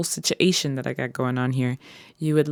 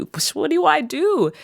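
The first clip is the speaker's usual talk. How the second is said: put-on higher, stammering old lady; higher flustered grandma asking what to do; 12 semitones higher than usual. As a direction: fluster like a lost granny. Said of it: "What was that conversation like?".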